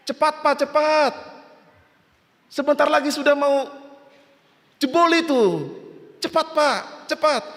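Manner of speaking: high, pressing rescuer's call; urgent calling. Acted. Rescue team calls the stranded man, high urgent shouting.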